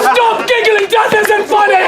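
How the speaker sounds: playful exasperated order; mock scolding. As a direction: scold the gigglers in a hammed-up shout. Mock scolding the giggling players.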